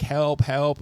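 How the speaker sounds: lightly voiced boy calling out for help; mock cry for help.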